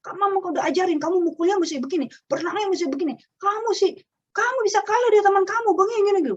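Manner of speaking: high, rising, fast-ending nagging; a parent losing it over a lost race; mock nagging parent. Mother nags her child after losing a swim race, high fretful nagging. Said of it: "Jangan kitanya terpancing emosi".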